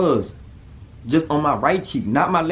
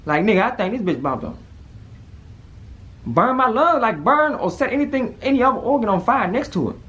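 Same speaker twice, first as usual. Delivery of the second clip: panicked; 10 semitones higher than usual. Retold or imitated. Retelling panic over his heart.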